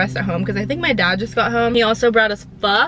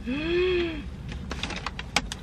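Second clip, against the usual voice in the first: high, soft gasp of delight; long delighted gasp; 7 semitones higher, 11 dB quieter than usual. Real, not acted. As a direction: gasp at the taste. First bite of corn dog.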